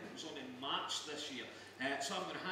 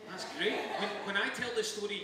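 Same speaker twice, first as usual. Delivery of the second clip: laughing; 4 semitones higher, 6 dB louder than usual. Real, not acted. Host laughing with guest.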